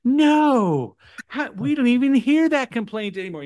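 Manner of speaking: high-pitched mock dismay; over-sympathetic agent. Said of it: "they're over there telling you".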